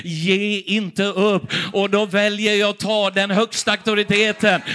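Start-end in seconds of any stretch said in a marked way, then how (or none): 0.0-1.4 slow, deliberate and weighty; slow emphatic quote. Retold or imitated. God's word to the sick preacher, slow and firm. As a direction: lay each word down slowly. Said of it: "Men han säger".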